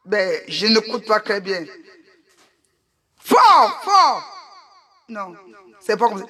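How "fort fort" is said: urging it louder. Pretend. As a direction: call out for more power. Urging echo to be loud. (réclamer plus fort, en criant)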